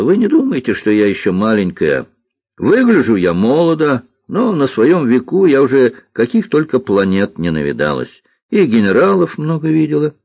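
put-on high, emphatic girlish voice; self-assured little girl. Girl tells cook of her experience, self-assured.

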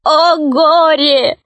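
high, drawn-out theatrical wail; sobbing gnome. A narrator's reading. Gnome mourns his lost bride, acted wailing. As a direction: lament slowly in a high gnome voice. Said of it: "рыдал гном".

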